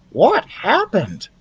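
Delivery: slow, high fascinated mouse; eager listener; high mouse, curious. Acted. Ralph voiced.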